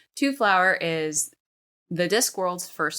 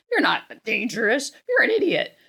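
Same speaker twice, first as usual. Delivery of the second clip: building to a loud high exclaim; rising exclaim; 6 semitones higher, 4 dB louder than usual. Real, not acted. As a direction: punch the idiot line, loud and high. Punchline about the tourist.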